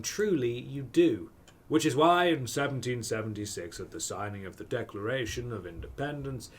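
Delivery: ordinary talk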